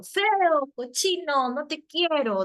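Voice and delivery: put-on high, loud childish outburst; mock child tantrum. Teacher imitating a child's angry insult. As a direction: shout it like an angry little kid. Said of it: "dice, ¿no?".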